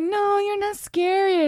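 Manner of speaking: high, slow teasing imitation; drawn-out mock reassurance; mock cooing. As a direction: coo it as if to something cute. Mimicking others cooing at her.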